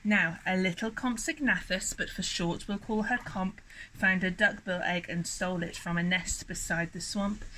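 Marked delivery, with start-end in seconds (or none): none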